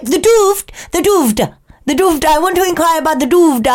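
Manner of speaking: insistent prank customer, pitched high; Dom Jolly. Retold or imitated. Co-host imitates a prankster insisting to shop staff, prank-caller impression. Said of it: "And he'd be like".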